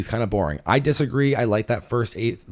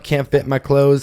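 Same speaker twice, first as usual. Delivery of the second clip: ordinary talk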